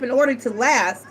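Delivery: usual speaking voice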